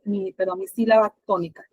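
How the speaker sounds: ordinary talk, nothing marked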